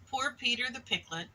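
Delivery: ordinary delivery, nothing marked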